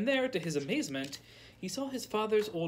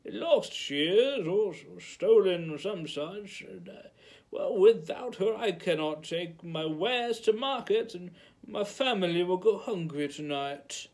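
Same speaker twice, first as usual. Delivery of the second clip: put-on old villager; 3 semitones higher, 3 dB louder than usual. A narrator's reading. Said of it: "he explained".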